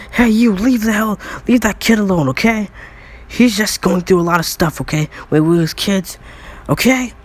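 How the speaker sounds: put-on sticking up for a kid, high and forceful; indignant scolding. Baku tells off bullies in story.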